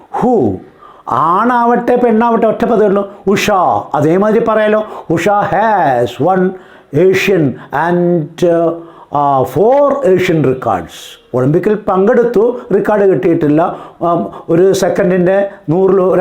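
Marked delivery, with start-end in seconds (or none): 5.1-10.9 slow, word by word example sentence; slow dictation. Shown as a demonstration. Teacher dictating an English example sentence to students, slowly. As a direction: spell out each word slowly for the class.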